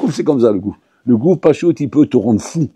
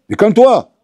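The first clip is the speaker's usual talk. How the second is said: calme-toi lancé fort et plus aigu, agacé; crier calme-toi; 8 semitones higher, 4 dB louder than usual. Acted. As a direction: hausser le ton sur calme-toi. Le dentiste s'énerve contre le patient. (calme-toi crié par le dentiste excédé)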